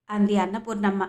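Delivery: ordinary delivery, nothing marked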